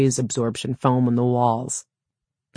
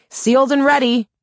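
high, loud shouted reply; raised, pitched-up call to a distant crewmate; calling back; 12 semitones higher, 5 dB louder than usual. A narrator's reading. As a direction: holler it back down the tunnel. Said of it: "Aaron called back".

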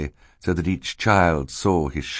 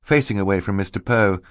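ordinary delivery

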